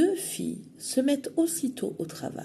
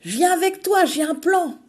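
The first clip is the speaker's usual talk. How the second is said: Emma plotting; 10 semitones higher, 9 dB louder than usual. Acted. Emma proposes her plan.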